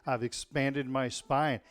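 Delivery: ordinary delivery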